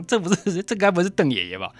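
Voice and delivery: amazed it might really be him; surprised realization. Retold or imitated. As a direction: gasp out the dawning guess. Startled recognition of grandpa.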